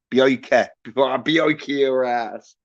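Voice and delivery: imitating an American accent, lightly; mock American accent. Mocking the actor's American accent for a YouTuber. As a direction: say bioike like a half-heard American tough guy. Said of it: "he'd be like".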